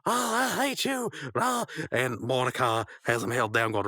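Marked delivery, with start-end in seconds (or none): 0.0-1.7 high-pitched cartoon tantrum; high mock-hysterical yelling; flailing outburst. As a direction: cry out wildly, pitched way up. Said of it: "Rigby's on the ground flailing".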